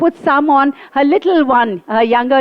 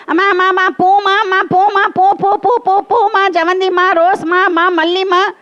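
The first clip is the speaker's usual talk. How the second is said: high, repetitive flower-seller calls; buzzing market vendors; 5 semitones higher, faster than usual. Retold or imitated. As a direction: imitate sellers calling over each other. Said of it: "flower market is buzzing".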